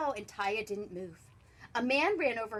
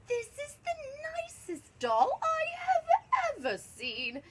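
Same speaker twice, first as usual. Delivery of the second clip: theatrical high-voiced woman; gushing lady shopper; 9 semitones higher, 5 dB louder than usual. A narrator's reading. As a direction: coo it high over a find. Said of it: "She said".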